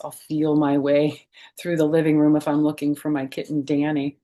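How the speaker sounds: ordinary delivery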